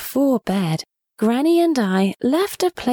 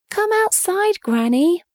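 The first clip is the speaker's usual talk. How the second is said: much higher call across the garden; 10 semitones higher than usual. A narrator's reading. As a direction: call out to someone far off. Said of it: "I called".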